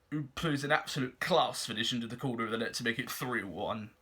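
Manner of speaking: ordinary delivery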